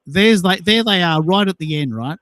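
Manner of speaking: ordinary talk, nothing marked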